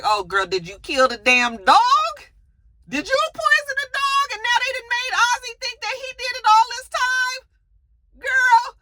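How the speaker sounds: squealing high in outrage; shocked disbelief. Retold or imitated. Reacting to the sister's confession, squealing high in shock. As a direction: gasp it out, voice shooting way up. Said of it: "So then I was just like".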